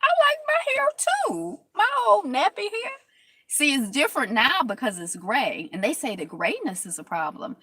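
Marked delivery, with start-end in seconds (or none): 0.1-3.0 much higher, delighted squeal over her hair; high gushing. Retold or imitated. She relives loving her natural hair, squealing high. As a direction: gush like a girl falling for her own hair. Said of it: "I'm like".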